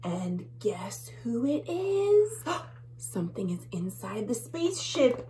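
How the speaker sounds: ordinary delivery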